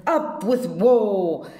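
loud war cry; hammed-up dinosaur roar of a cheer; shouted. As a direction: bellow it like a fierce dinosaur. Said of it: "And they shouted".

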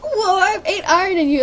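laughing squeal. Real, not acted. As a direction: giggle through it. Laughing while playing.

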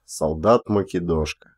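ordinary delivery, nothing marked

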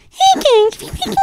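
extremely high, loud squeaky greeting; exaggerated chipmunk-voice imitation; mock minion squeak. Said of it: "Bro turned into a minion in two years".

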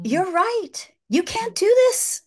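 excited voicing of God. Voicing God speaking to her.

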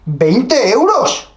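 high, stretched-out disbelief; outraged at the price; shocked. Real, not acted.

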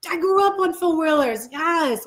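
high-pitched, enthusiastic cheer; excited. Real, not acted. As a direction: burst out with delight at a shared memory. Delighted reaction to a comment.

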